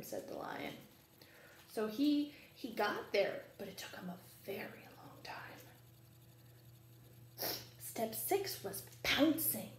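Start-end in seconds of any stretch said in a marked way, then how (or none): 3.6-5.6 soft whispered aside; quiet, quick whisper. Real, not acted. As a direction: hush it like a secret. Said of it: "whispered aside".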